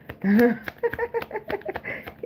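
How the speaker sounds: helpless giggle at the drama; real high-pitched laugh; high squealing laugh. Real, not acted. Laughs at the fallout.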